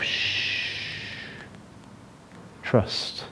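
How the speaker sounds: soft whispered shush to the heart; hushing whisper. Pretend. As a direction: whisper it like calming yourself. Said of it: "He's almost saying to himself".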